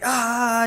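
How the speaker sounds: drawn-out yell of a trapped doll; Chucky. Retold or imitated. Imitating Chucky with trapped hand.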